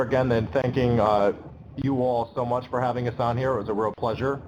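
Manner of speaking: ordinary talk, nothing marked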